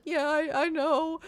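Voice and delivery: high tearful agreement; mock crying. Answering the agent about no flights tonight, mock crying. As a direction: imitate answering through tears. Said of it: "when you're about to cry".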